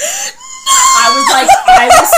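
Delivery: very high, loud laughing yell; shrieking with laughter; laughing outraged shriek. Real, not acted. Furious upset reaction.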